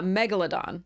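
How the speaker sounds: megalodon stressed on the first syllable; correct pronunciation. Shown as a demonstration. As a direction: say MEG-uh-luh-don for megalodon. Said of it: "the correct pronunciation is".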